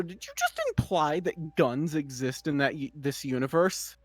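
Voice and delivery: ordinary talk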